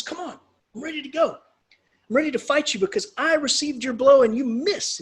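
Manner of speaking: high, loud fighting talk; acted knight's indignant challenge; eager challenge. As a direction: burst out, raring for a fight.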